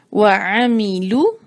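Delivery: وعمیلو drawn out very slowly, syllable by syllable; slow recitation. Shown as a demonstration. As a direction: stretch وعمیلو slowly with rounded lips on لو. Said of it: "لو کو آگے ملائیں گے". (وعمیلو بہت آہستہ، ہر حصہ کھینچ کر)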